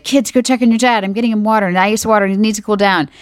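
retold rush of instructions to the kids; urgent mom orders. Wife sending kids to check on their sick dad, urgent.

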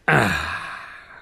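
low, contented ah; satisfied sigh. A narrator's reading. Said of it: "a large sigh of satisfaction".

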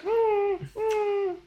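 very high, soft whining hum; dog whimper. Retold or imitated. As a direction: whine like a sad dog. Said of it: "It's like".

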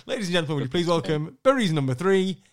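big booming arena intro, pitch climbing on the name; MC ring announcement. Retold or imitated. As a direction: announce him to the crowd like a ring MC. MC introduces player on stage.

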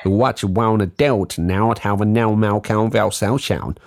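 hammed-up American accent; mock American, vowels twisted for laughs. Shown as a demonstration. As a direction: show off a silly fake American accent. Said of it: "my American accent. Watch".

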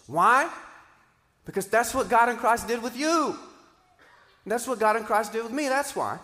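loud, high, ringing preacher's call; full-force preaching. Acted. Pastor driving home why to the congregation, loud, ringing preaching. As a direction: preach it out to the whole room.

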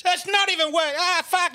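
very high mock sobbing; hammed-up wail of despair; crying. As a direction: break into comic, high-pitched crying. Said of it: "He starts crying".

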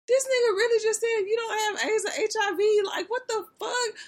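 high, loud disbelieving yell; outraged shout. Retold or imitated. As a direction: scream it in total disbelief. Retelling her shocked tweet.

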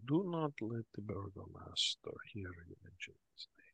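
hushed, whispered warning from a barkeep. Acted. Barkeep warns visitor about the burgomaster, in a hushed whisper. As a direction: drop to a secretive whisper. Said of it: "he lowers his voice a bit".

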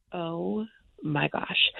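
slow, drawn-out groan of dismay; dread. Retold or imitated. Asked to sing at the open call, slow dismayed groan. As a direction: drag it out in dismay.

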